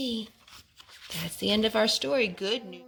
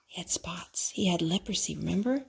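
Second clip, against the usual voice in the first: whisper; 2 semitones lower, 8 dB quieter than usual. Real, not acted. Parent whispering the answer to her son.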